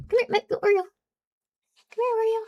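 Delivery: high cooing call to the cat; high coaxing. Real, not acted. Coaxing the cat in a high cooing voice. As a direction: coo her over gently.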